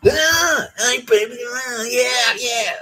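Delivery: loud, high, sleazy pickup-line voice; sleazy mock voice. Mocking the housemate hitting on the girl, loud and high. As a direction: ham up a leering creep calling out to a girl.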